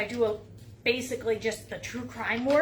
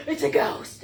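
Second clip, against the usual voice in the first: mock scared; 6 semitones higher, 7 dB louder than usual. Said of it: "when I'm like".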